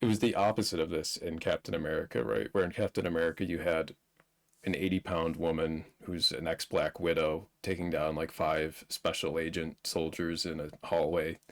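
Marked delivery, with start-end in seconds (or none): none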